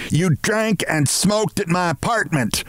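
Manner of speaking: high-pitched angry bawl, controlled volume; bawled accusation. A narrator's reading. Missus Barrows accusing Mister Martin, bawling. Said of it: "she bawled at Mister Martin".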